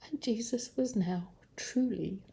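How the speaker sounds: soft, breath-led near whisper; quiet, solemn closing line; hushed, sorrowful. A narrator's reading. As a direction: let the ending fade away sadly.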